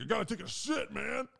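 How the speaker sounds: high-pitched impression of a desperate pal; mock urgent friend. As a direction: imitate an urgent buddy, voice pushed up. Re-enacts friend's urgent words.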